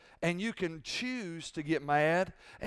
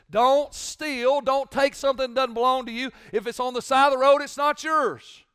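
louder, higher preacher's push; rising preaching; 6 semitones higher, 6 dB louder than usual. Acted. Pastor pressing the church not to lie or steal. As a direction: drive each command home.

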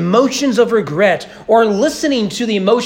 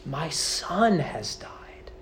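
whispered, slow and broken; grieving whisper; 2 semitones lower, 10 dB quieter, much slower than usual. Acted. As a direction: breathe it out like a father who just lost his son. David's grief voiced in whisper.

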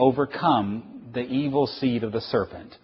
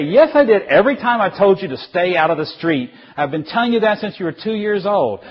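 high, loud, insistent retort; worked-up parental scolding; exasperated parent; 8 semitones higher, 6 dB louder than usual. Acted. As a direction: shout back in an acted family quarrel. Parent shouts back in acted quarrel.

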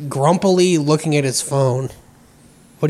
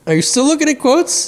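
high teasing call; 11 semitones higher than usual. Real, not acted.

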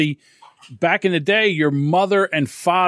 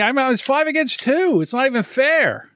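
mock outrage; 11 semitones higher than usual. Complaining about kids outnumbering.

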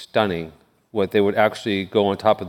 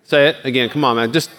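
normal talking voice

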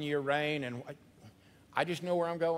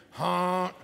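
goose honk; 6 dB louder than usual. Shown as a demonstration. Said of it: "Say it with me".

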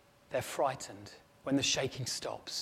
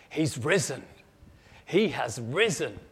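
louder, ringing announcement; triumphant proclamation; 5 dB louder than usual. Acted. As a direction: declare it with joy. Angel proclaims resurrection.

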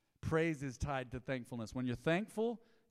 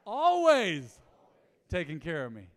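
loud, high, soaring call to the congregation; preaching at full force; 4 semitones higher, 12 dB louder, slower than usual. Real, not acted. Pastor leading his church in a call, preaching loud. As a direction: lift it up and ring it out.

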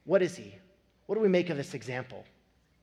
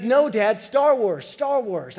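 put-on higher, louder pleading kid; insistent child; 6 semitones higher, 9 dB louder, slower than usual. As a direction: beg Dad like an eager four-year-old.

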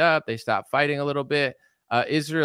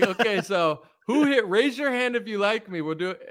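higher, lightly voiced dictator; mock dictator poll; 9 semitones higher than usual. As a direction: play a smug leader running a poll. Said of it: "He's like".

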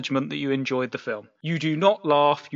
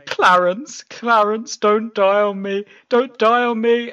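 mock crying plea; 7 semitones higher, 5 dB louder, slower than usual. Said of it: "he's crying".

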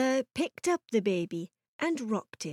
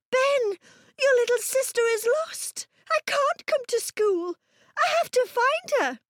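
very high-pitched worried mum; frantic mother; 14 semitones higher than usual. A narrator's reading. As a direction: fret in a high, urgent voice. Frantic mother has lost her daughter.

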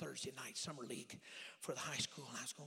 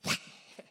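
burst of laughter; 8 dB louder than usual. Real, not acted. Remembering the dunking.

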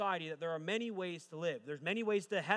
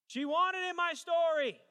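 very high, loud, slow cry; hammed-up outrage; 12 semitones higher, 8 dB louder, slower than usual. Real, not acted. Preacher telling congregation about his ex, hammed-up shouting. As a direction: belt it out as a comic climax.

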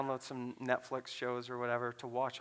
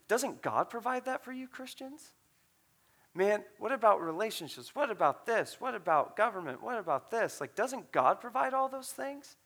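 higher-pitched, insistent string of questions; retold challenge; 7 semitones higher than usual. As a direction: fire off indignant questions, pitch lifted. Retold stranger's tirade of challenging questions.

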